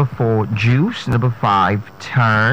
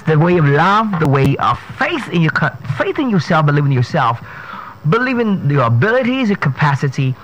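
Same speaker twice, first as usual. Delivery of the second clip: motivational pep talk; 5 semitones higher than usual. Acted. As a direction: lift them up like a coach. Motivational sign-off.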